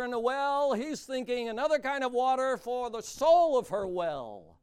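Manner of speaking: loud, soaring pulpit voice; preaching at full force. Acted. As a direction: proclaim the invitation with full force. Preaching the well story.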